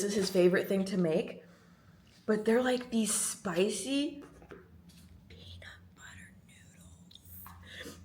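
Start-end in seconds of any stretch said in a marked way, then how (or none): 5.3-8.0 slow, awed whisper; dramatic whisper. Real, not acted. Revealing spicy dish to viewers, slow reverent whisper.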